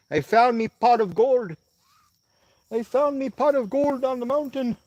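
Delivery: high, loud mock Irish leprechaun. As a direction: crow it like a giddy leprechaun. Mock leprechaun on finding signal.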